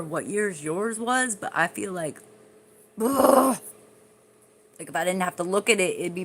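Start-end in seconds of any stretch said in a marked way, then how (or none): 3.0-3.6 disgusted groan. Real, not acted. Disgusted at own skin.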